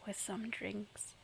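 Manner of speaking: slow conspiratorial whisper; hushed, slow whisper. Real, not acted. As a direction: whisper it like a naughty secret. Teasing a drinking episode.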